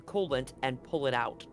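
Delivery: normal talking voice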